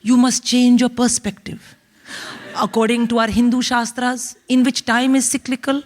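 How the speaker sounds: put-on grandly philosophical dad with an Indian accent; erudite Indian father. Father consoling tearful daughter by phone, erudite and grandiose. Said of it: "so my father's very erudite".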